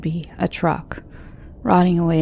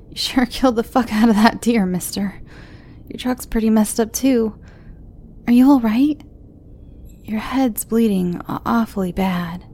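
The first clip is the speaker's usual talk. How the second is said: put-on high, hesitant young girl; worried young girl voice; teen girl voice; 4 semitones higher than usual.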